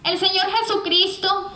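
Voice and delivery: normal talking voice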